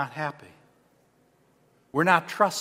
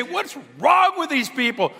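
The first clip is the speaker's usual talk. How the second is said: exasperated; 7 semitones higher than usual. Retold or imitated. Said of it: "look at these people and say".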